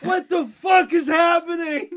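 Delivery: slow, high, loud panicked yell; panicked shout. Retold or imitated. Panicked soldier.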